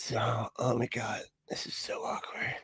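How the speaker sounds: imitating an inner whisper in a tense quiet room; awkward whisper. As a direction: hush it like you're afraid to break the silence. Voicing thoughts during awkward silence.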